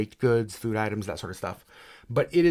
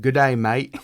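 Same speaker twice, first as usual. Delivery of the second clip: put-on cheesy Australian-sounding British accent, louder; mock British accent; 7 dB louder, slower than usual. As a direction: throw out a hammy Aussie-Brit greeting. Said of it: "You could speak in a British accent".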